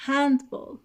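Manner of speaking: "handball" slow with HAND punched; exaggerated stress. Shown as a demonstration. Teacher demonstrating stress to students: slow "handball", HAND hit. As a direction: drag out "handball", lean on HAND.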